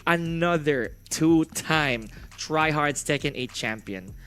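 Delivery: ordinary talk, nothing marked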